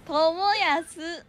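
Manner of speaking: トモヤス very slow, high and loud; repeat-after-me. Shown as a demonstration. Co-host repeats after host, トモヤス slow and loud. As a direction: echo トモヤス loudly, stretching every vowel. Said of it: "はい、リピーターしたみ".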